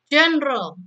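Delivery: General said GEN-ral, two syllables; English General in two beats. Shown as a demonstration. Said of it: "ini juga dua silabel".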